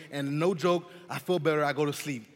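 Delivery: ordinary talk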